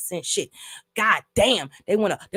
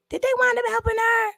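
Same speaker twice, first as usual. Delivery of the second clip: side question. Real, not acted. Asks someone off to the side.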